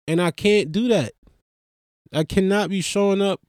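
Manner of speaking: normal talking voice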